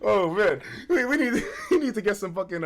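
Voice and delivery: talking through laughter; real laughter breaking words; laughing. Real, not acted. Laughing at the story, real laughter.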